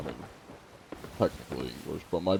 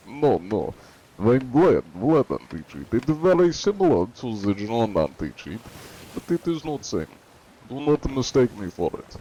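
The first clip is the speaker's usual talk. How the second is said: put-on Russian accent; 3 semitones higher, 6 dB louder than usual. Streamer playing Vlad to fool inspected players, Russian accent. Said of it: "in my Russian accent".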